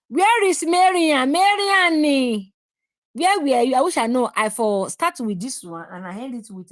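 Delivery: loud call across the room. Real, not acted. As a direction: shout it out to someone far away. Calling out loudly for Marian.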